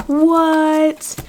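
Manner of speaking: high, stunned what; a real little shriek of amazement; amazed what. Real, not acted. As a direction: gasp it out in disbelief. Stunned by the detailed deck.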